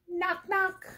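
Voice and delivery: high, playful knock knock; mock knock. Police knocking on the bear's door, playful high voice. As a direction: sing it out like cops at the door.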